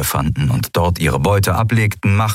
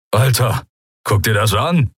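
lightly voiced Skria, a little higher; Skria calling out; 4 semitones higher than usual. A narrator's reading. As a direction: call a mate over, mildly excited. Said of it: "hörte sie einen Skria rufen". (leicht gespielter Ausruf eines Skria)

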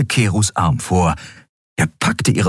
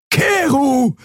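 high, alarmed call of the name; drawn high shout of alarm; exclaiming. Acted. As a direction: cry out in dismay, pitched high. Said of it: "rief Xea aus".

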